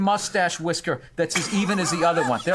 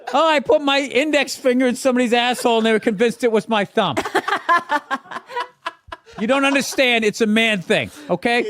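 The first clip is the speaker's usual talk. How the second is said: put-on moody boyfriend voice; 5 semitones higher, 4 dB louder than usual. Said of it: "his girlfriend's like".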